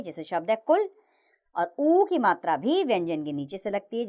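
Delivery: normal talking voice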